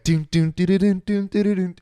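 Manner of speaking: sung doon doo play sounds, rising; sword fight noises. Retold or imitated. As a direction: hum a kid's fight tune.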